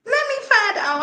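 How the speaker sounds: very high, squeaky fake tearful voice; mock boo-hooing. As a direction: squeal it as if about to bawl. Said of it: "I'm about to be out here boo-hooing".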